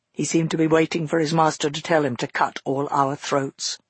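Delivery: ordinary delivery, nothing marked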